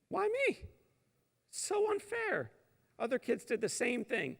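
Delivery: put-on high whiny kid voice; whiny child. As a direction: whine it like a sulky child.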